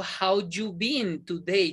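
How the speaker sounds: "How you been today?" slow, words apart. Shown as a demonstration. Teacher demonstrating what not to say, slow and unlinked. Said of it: "Yo no digo".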